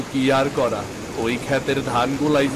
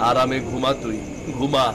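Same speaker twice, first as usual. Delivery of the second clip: ordinary talk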